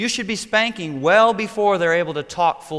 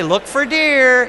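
high, loud, slow call; mock-excited parent; 8 semitones higher, 9 dB louder, much slower than usual. Parent to child in car seat on long drive, excited calling. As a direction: draw it out to distract a child.

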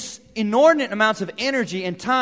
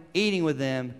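usual speaking voice

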